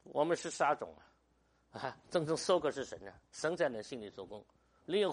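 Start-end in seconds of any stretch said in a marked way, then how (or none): none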